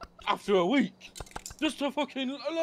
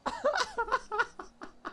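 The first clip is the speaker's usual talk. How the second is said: high laugh; 5 dB quieter than usual. Real, not acted. Cracking up mid rant about the crew, high laugh. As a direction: laugh at your own rant.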